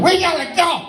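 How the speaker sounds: high-pitched imitated yell; rally friends to flee, voice way up; urgent call. Acts McLaughlin urging escape.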